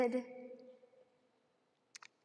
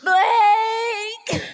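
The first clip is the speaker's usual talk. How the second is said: loud, high panicked shout of a name; desperate scream into the rubble; frantic call; 6 semitones higher, 9 dB louder than usual. Acted. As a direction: yell for your lost child. Mother searching for her son.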